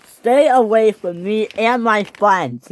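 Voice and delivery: slow, high, loud threat; angry warning. A narrator's reading. Bash to Percy while hitting him, angry warning. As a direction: warn him off forcefully.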